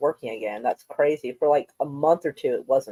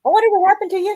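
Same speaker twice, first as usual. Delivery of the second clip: mock worried voice; 13 semitones higher, 6 dB louder, faster than usual. Mock worried call to missing friend.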